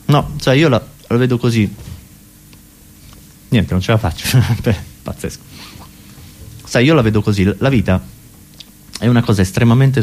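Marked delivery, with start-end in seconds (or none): none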